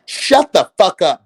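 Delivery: ordinary delivery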